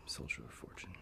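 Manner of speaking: whispered retort under the breath; muttered aside. Acted. Lieutenant scorning the scout behind the general's back, muttered. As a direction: slip it out too softly to be caught. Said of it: "Sorry, what was that?".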